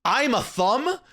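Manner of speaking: mock a wrestler with no personality; Solo. Host mocking a wrestler with nothing to offer, mild mock boast. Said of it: "Solo has nothing".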